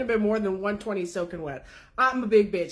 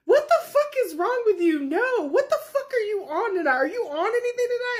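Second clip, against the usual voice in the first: shocked outburst; 12 semitones higher than usual. Retold or imitated. Yell in total disbelief.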